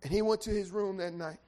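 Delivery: loud, high whooping sermon climb; preaching at full force. Real, not acted. Preacher whooping to the congregation, loud and high. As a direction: build the sermon to a roaring peak.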